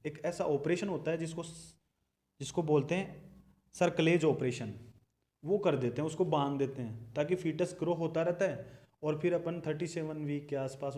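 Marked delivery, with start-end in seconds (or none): none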